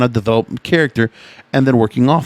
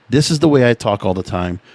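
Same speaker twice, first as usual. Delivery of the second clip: ordinary talk, nothing marked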